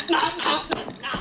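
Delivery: ordinary talk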